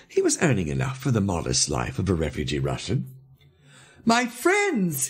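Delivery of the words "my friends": high, drawn-out call to a group; high excited cry. A narrator's reading. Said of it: "he cried".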